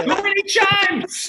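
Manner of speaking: high, slow, drawn-out whine; silly high voice. Acted. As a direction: stretch it out in a silly falsetto. Repeats line in silly voice.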